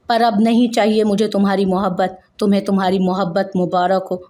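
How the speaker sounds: ordinary delivery, nothing marked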